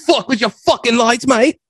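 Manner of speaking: hammed-up star telling off the crew; mock Bale rant. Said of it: "he's like".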